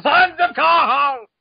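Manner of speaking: loud, slow, drawn-out yell; sudden shout. Real, not acted. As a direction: belt it out so the neighbours hear. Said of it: "apologize to my neighbors".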